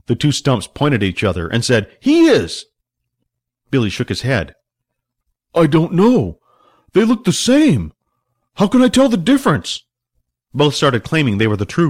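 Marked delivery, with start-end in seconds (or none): 2.0-2.6 high, emphatic accusation; accusing shout. A narrator's reading. Two lookalike brothers point at each other, accusing loudly. 5.5-9.8 high, frantic protest; exasperated panic. A narrator's reading. Billy cannot tell the twins apart, frantic.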